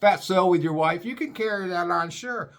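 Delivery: high, breezy mock agent voice; mocking airline agent. Agent waving the fat man's bag through, mock high breezy. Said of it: "They were like".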